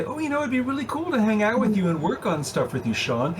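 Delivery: high, eager fake enthusiasm; gushing fan voice. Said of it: "a lot of people say".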